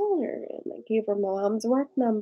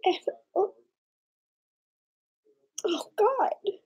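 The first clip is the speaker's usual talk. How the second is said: real tears, whispered; overwhelmed, whispering through tears; choked whisper; 10 semitones higher than usual. Real, not acted. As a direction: barely get the words out through crying. Overcome while telling the story.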